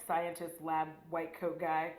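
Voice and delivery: normal talking voice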